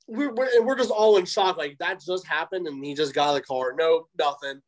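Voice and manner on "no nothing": normal talking voice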